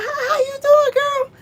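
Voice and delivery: high, bright fake-sweet hello; a high sugary hey girl; sweet greeting. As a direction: sing out a high friendly greeting. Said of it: "so I'm like".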